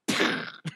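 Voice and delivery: sputtering breathy blast with no voice; imitated gunshot noise. Drone shot over the match, imitated blast noise. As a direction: mouth the sound of a drone being shot apart. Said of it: "you hear this".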